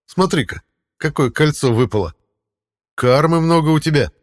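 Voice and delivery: high, loud cat voice. Acted. Cat speaks in story.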